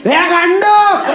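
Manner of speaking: high, loud, drawn-out insult; fighting shout. Acted. Someone starting a fight, high angry shout. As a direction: yell it across a street brawl. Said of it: "अभी किसी से लड़ाई हो जाए".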